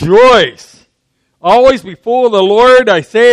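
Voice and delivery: Rejoice called out loud and strong, falling at the end; a ringing, full-voiced Rejoice; loud proclaimed rejoice. Shown as a demonstration. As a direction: proclaim the word Rejoice to the room. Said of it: "Come on, I know some of you have this memorized".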